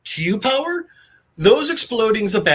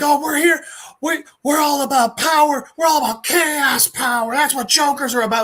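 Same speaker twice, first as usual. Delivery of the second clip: higher, boastful villain chant; lightly voiced rowdy goons, pitched up; mock Jokers gang; 9 semitones higher than usual. Jokers boasting about chaos to Terry, mock bravado.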